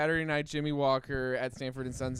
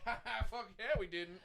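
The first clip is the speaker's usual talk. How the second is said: jeering laugh; 8 semitones higher, 8 dB quieter than usual. Retold or imitated. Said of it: "they were like".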